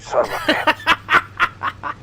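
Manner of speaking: slow, laughing exasperated curse. Retold or imitated. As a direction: grudgingly laugh it out.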